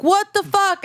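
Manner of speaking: high-pitched mock outrage; retold outraged driver. Driver to teen who rear-ended them, mock indignant. Angry driver after being hit.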